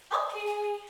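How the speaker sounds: high, loud, bright "Okay"; really chipper okay. Shown as a demonstration. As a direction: pop the "Okay" up high like a treat. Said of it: "I said it in a really chipper tone of voice".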